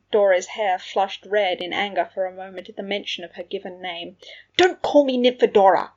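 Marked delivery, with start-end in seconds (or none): 4.6-5.9 loud, high indignant outburst; angry shout. A narrator's reading. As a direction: snap it out far too loudly. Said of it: "she shouted a bit too loudly".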